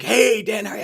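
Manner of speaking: cheery greeting. Retold or imitated. Kid greets him at grocery store.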